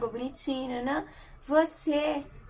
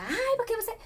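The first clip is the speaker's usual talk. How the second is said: retold yelling; 10 semitones higher than usual. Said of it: "eu tava gritando com o Samuel".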